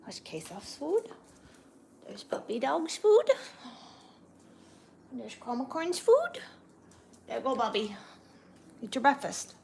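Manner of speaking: high sing-song voice for the pets; pet talk voice. Real, not acted. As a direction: coo at the pets like they're babies. Feeding the pets breakfast.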